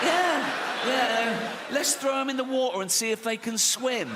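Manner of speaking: high, gleeful mock enthusiasm; hammed-up eager organiser; eager suggestion. Voicing eager Special Olympics organisers.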